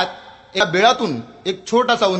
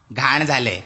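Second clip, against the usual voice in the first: a lower, deadpan offer; dry librarian; 3 semitones lower, slower than usual. Acted. Librarian offers a different book, low and deadpan. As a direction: offer the swap without a smile.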